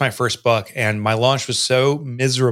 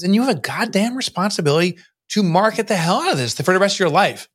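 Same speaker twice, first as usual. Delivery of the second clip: mentor's blunt demand; 8 semitones higher than usual. Retold or imitated. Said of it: "He goes".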